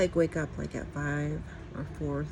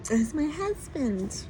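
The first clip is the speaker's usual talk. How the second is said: playful quote; 9 semitones higher, slower than usual. Retold or imitated. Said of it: "It's like".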